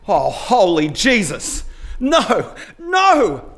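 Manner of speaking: slow oath rising to high cries; aghast, climbing into a high drawn-out wail; horrified dismay. A narrator's reading. Cyclist realising he burned the wrong house, horrified.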